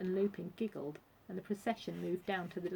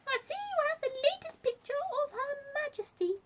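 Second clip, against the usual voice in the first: very high-pitched squeaky voice; squeaky Duchess. A narrator's reading. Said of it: "squeaked the Duchess".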